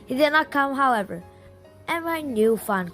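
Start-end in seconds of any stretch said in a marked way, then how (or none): none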